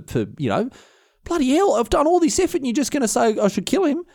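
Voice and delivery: high, halting mock incredulity; stunned disbelief. William protesting to Agnes about her brother, stunned disbelief. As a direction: plead and stammer, comically aghast. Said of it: "who is stunned with disbelief".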